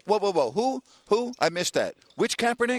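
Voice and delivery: high-pitched, incredulous questioning; baffled disbelief. Real, not acted. Host halts caller over a confusing name, baffled disbelief. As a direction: stop them short, utterly baffled.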